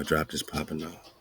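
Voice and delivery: ordinary talk, nothing marked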